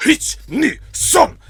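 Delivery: loud, high barked count in Japanese; powerful sensei count. Retold or imitated. Telling a friend about the old sensei, a loud barked count. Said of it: "this very powerful, like, throaty voice".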